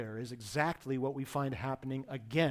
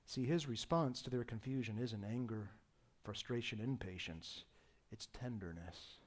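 much softer and lower, drawing close; hushed tenderness; 5 semitones lower, 11 dB quieter than usual. Acted. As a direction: sink the voice to a near hush. Sermon softening to intimate point.

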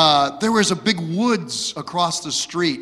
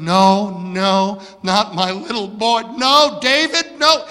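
mom protesting; 3 semitones higher, 4 dB louder than usual. Retold or imitated. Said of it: "arguing with him".

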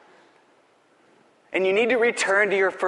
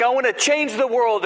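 higher, mocking sing-song; mocking silly talk; 8 semitones higher, 4 dB louder than usual. Preacher mimics churchgoers' grand claims, higher and mocking. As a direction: send up a lofty boast. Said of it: "silly things like".

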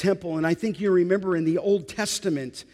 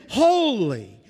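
higher, drawn-out cry of praise; 3 semitones higher, 3 dB louder, slower than usual. Acted. Seraphim calling around the throne, crying out in praise. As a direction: cry it out, pitch lifted then falling. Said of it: "They are crying out in antiphonal praise".

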